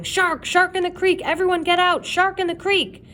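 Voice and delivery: high, loud alarm shout. A narrator's reading. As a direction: bellow the warning from the boat. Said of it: "Captain Wilson's voice shouting".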